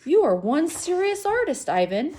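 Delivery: bright impressed compliment. Acted. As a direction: marvel at the artist. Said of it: "Julia shakes her head".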